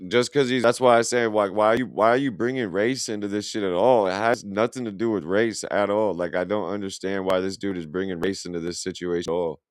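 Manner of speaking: ordinary talk, nothing marked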